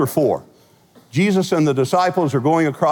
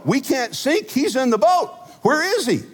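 panicked disciples; 10 semitones higher than usual. Retold or imitated. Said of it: "they know".

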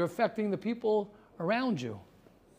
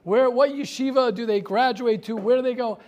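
raised, louder pushy asking; mock challenge, louder and higher; demanding question; 3 semitones higher, 7 dB louder than usual. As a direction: press him like a doubting parent. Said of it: "Everyone wants to know".

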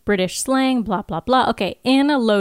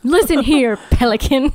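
mock-stern, louder British scold; stern Giles scolding; 5 semitones higher, 4 dB louder than usual. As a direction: tell him off like a tutor.

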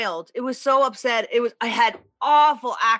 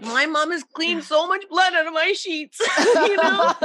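higher, laughing as she talks; 7 semitones higher than usual. Real, not acted. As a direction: giggle through the confession. Laughing about mom cleaning sheets.

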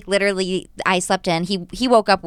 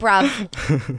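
"bruv." drawn out in a British accent, falling; London slang "bruv." with a dropping end. Shown as a demonstration. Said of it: "See what you've got".

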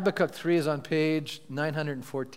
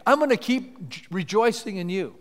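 higher, louder proclamation building to triumph; fervent scripture preaching; 5 semitones higher, 6 dB louder than usual. Acted. Pastor declaring Habakkuk's faith to the church, triumphant preaching.

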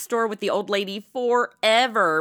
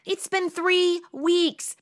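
higher, slowly drawn-out grievance; exasperated complaint; 8 semitones higher, much slower than usual. Acted. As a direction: stretch each word in exasperation.

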